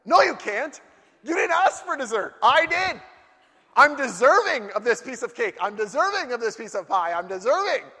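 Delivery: hammed-up outrage over cake; possessive rant. Ranting over his cake.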